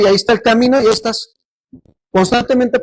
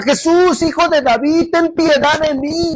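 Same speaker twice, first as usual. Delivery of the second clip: crying out to Jesus; 8 semitones higher than usual. Retold or imitated. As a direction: call out desperately to be heard. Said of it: "Que le gritaba".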